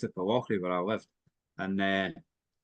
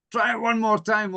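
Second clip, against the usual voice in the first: high coaxing call; 10 semitones higher, 4 dB louder than usual. Retold or imitated. Driver urging police.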